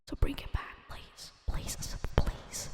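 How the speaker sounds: soft pleading whisper; whispered begging. Real, not acted. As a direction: whisper please, please, like a prayer. Begging Asobo to bring it back.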